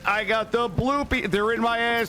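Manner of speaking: normal talking voice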